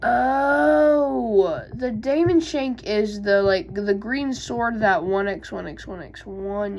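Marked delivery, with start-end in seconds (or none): 0.0-1.6 sudden bright realization; a loud oh of discovery; surprised oh. Real, not acted. Finds the answer in search.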